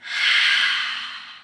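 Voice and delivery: long audible exhale; slow relaxing sigh; deep calming sigh. Shown as a demonstration. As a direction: breathe out to relax. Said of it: "let's relax".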